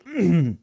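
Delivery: quick throat clear. Real, not acted. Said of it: "annoyed by my coughing".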